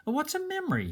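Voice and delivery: put-on high, curious little boy voice; small boy. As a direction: ask it like a wide-eyed child.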